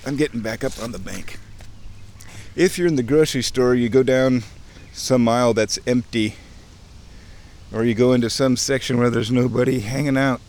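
usual speaking voice

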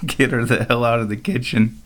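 usual speaking voice

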